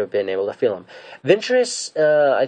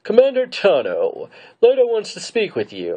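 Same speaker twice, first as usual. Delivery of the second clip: hologram officer; 11 semitones higher than usual. Retold or imitated. Tarkin summoning the commander over hologram, in a put-on official voice. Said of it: "Tarkin comes up on the hologram".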